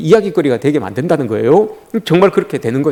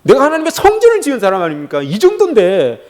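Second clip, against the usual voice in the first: high, insistent boasting to God; showing oneself off; 4 semitones higher than usual. Acted. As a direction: parade your achievements, pitch leaping up. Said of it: "나를 드러낼 때는".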